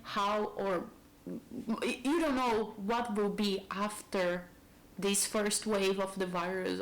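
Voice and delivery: ordinary talk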